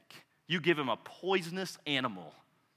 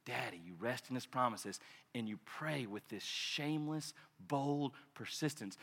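soft intimate; 5 semitones lower, 13 dB quieter than usual. Acted. Intimate soft sermon passage.

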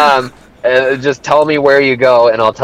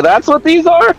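higher, faked surprised question; mock surprise. Joking along with cohost: mock surprised. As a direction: play dumb about it.

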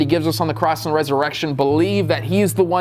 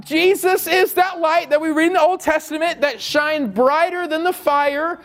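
fiery preaching; 9 semitones higher than usual. Acted. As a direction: preach it out, rousing the crowd. Preaching at full force.

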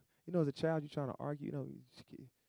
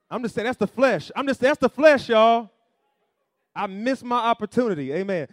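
loud, higher sermon outburst; preaching at full force; 8 semitones higher, 13 dB louder than usual. Acted. Pastor calling out to y'all, preaching loud. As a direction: throw it out to the room, full voice.

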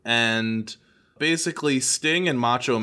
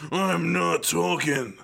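drawn-out, raised-pitch mock scream; Macho Man; 6 semitones higher, slower than usual. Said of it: "scream into the mic".

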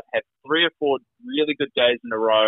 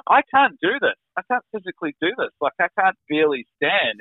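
high, pleading retold refusal; higher-voiced desperate insistence; distressed protest; 8 semitones higher than usual. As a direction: plead that it is beyond you, pitch well up. Retold protest to hospital staff.

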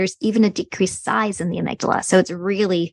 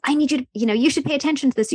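quick, higher, pressing inner voice; urgent brain voice; 6 semitones higher, much faster than usual. Retold or imitated. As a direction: hurry the warning like an alarmed brain. Brain voice urging attention.

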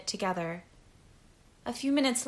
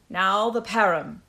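loud, impatient order; 6 dB louder than usual. A narrator's reading. Captain ordering the drug brought out, impatiently. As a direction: demand the next step impatiently. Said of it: "Hoda said impatiently".

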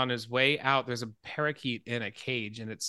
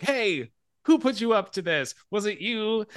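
higher, louder quoted producer; lightly acted, higher-pitched playful challenge; amused producer; 8 semitones higher, 5 dB louder than usual. Producer quizzing the supposed singing telegram, amused.

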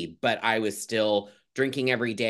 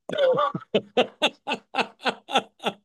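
high laugh; 4 dB louder than usual. Real, not acted. Big laugh at own joke.

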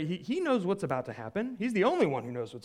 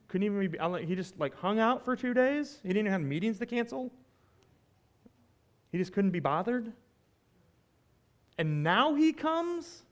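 higher, incredulous complaint on Martha's behalf; indignant disbelief; 5 semitones higher, 4 dB louder than usual. Acted. Preacher voicing Martha, let down by a friend, indignant. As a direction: voice the hurt of a let-down friend, pitch climbing.

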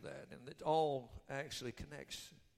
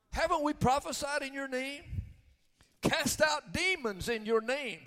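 high, loud, insistent protest; arrogant, belligerent; 10 semitones higher, 6 dB louder than usual. Acted. The many boast of works to Jesus, loud arrogant protest. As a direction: push each claim like a challenge. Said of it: "It's an arrogant, belligerent".

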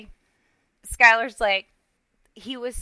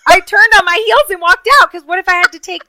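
loud, high and indignant; worked-up retelling; 8 semitones higher, 11 dB louder than usual. Real, not acted. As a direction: blurt it out high and loud, all flustered. Worked-up retelling of fleeing in embarrassment.

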